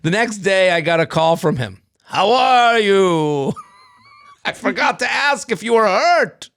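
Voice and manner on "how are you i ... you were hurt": put-on higher, slow, loud old man; hammed-up sweet old caller; old man phoning the driver, high and slow; old man on phone. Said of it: "he said on the phone".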